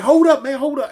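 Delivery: loud, high startled yell; mock alarmed shout. Young homie faking an attack, loud high yell. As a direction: shout it to stop him. Homie pretends to fire on him.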